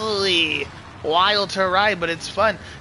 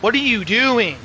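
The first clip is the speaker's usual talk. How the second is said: loud, higher, exasperated demand; angry exclamation; 6 semitones higher, 6 dB louder than usual. Real, not acted. Rider griping at another in his rut, angry exclamation. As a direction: call out a rider who got in the way.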